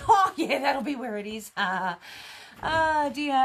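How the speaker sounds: laughing loudly while talking. Real, not acted. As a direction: laugh through the words. Laughing at own joke.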